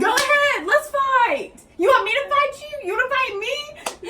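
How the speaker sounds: loud, high taunting fight challenge; high mocking dare. Mom goading her daughter to fight, high and loud. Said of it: "Let me reenact this for you".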